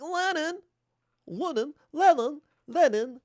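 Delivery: very high, put-on wifely calling; mock Krupskaya. Wife calling to her husband Lenin, high mock-pleading voice. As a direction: call out in a high, hammed-up complaining voice. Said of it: "Krupskaya is like a dumb bitch".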